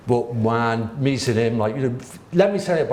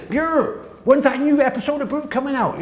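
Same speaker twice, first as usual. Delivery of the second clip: put-on higher-pitched Bristol accent for a street kid; 12 semitones higher than usual. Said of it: "I'll do the Bristol accent".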